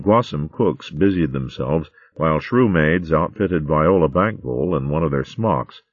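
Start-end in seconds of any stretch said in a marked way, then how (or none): none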